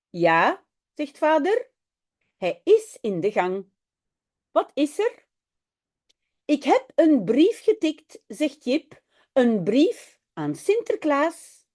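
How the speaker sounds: lively read-aloud voice with little character voices; story narration. Acted. Teacher reading a Dutch story to her students, lively read-aloud. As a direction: read it like a bedtime story for kids.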